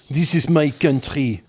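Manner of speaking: slow, lightly put-on Belgian action hero; Van Damme impression. Imitating the Belgian policeman as an action star, slow. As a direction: drawl it like a tough action star. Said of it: "like Jean-Claude Van Damme".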